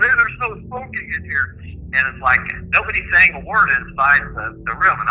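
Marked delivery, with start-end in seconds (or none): 0.0-1.6 mock passerby, higher; a hint of a scolding hotel guest. Passersby telling the room there's no smoking, lightly voiced. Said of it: "people are walking by going".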